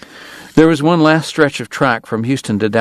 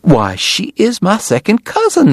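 high, slow Southern exclamation; delighted high-pitched Southern lady; crying out, thrilled; 11 semitones higher than usual. A narrator's reading. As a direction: exclaim it high, drawing out the words. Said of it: "Missus Segar cried".

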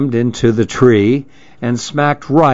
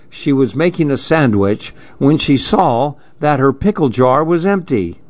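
plain reading voice

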